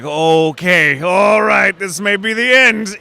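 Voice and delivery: high, loud self-psyching outburst; mock panic. Actor retelling his nerves over high stakes, mock panic. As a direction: steel yourself loudly, pitch climbing as if doomed.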